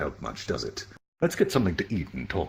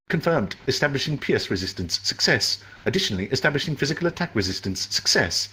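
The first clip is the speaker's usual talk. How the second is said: lightly voiced AI announcement; even, detached computer voice; system voice; 7 semitones higher than usual. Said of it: "his voice was strange".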